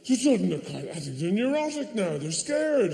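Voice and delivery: ordinary delivery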